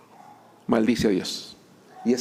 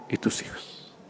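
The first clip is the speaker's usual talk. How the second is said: breathy whispered aside, voice dropped right out; whispered for emphasis; 9 dB quieter, slower than usual. Real, not acted. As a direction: let the last words fall to a whisper. Preacher drops voice for emphasis.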